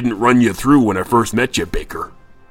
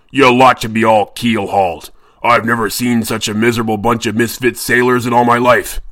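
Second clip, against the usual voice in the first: much louder, frightening captain's roar; Redbeard's bellow; 9 dB louder than usual. A narrator's reading. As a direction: bellow at lazy sailors. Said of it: "in Redbeard's frightening voice bellowed".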